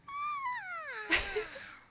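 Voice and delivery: high shrieking laugh of joy; real high-pitched laughing shriek; delighted squealing laugh. Real, not acted. Overjoyed squeal at the scene.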